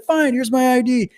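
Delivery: high-pitched, chipper imitation; cheerfully compliant retold reply in a high voice; super happy. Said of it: "He was super happy".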